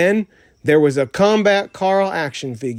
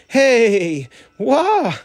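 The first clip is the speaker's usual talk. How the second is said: high yelp of surprise; startled cry; 8 semitones higher, 5 dB louder than usual. Acted. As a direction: caught off guard, jump up in pitch. Pig grabs Jesse, startled cry.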